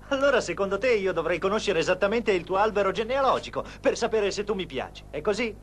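passionate insistence. Acted. Professor argues passionately about feelings.

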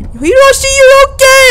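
put-on high, loud Valley Girl gush. Player voicing game character's relief, loud high Valley Girl. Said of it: "a Valley Girl accent".

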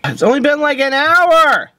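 high, loud whining yell. Acted. Bored detective yelling on the stakeout, loud. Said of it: "you have to stop yelling".

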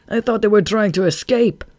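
high, loud protest; defensive excuse. A narrator's reading. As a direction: plead his case to the boss. Follower defends himself to boss.

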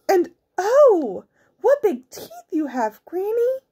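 put-on high, wobbling girl voice; very wobbly voice. A narrator's reading. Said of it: "in a very wobbly voice".

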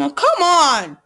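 loud impatient come on. Real, not acted. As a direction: urge the slow download. Impatient at slow download.

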